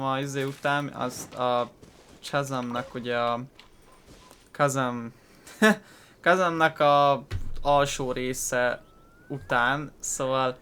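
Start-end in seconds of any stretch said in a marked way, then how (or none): 5.5-5.9 quick burst of real laughter; short laugh. Real, not acted. Streamer laughs after botching a name to viewers, short real laugh. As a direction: laugh at your own slip of the tongue.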